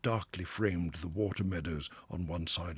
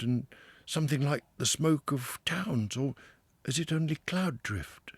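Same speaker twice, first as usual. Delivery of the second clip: higher, wondering Mole voice; a newcomer seeing the river for the first time; Mole, awed and a little breathless; wide-eyed Mole; 9 semitones higher, 6 dB louder than usual. A narrator's reading.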